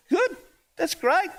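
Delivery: bright cheerful reply. Retold or imitated. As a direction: sound delighted, a little hammed-up. Cheerful reply to drunk man.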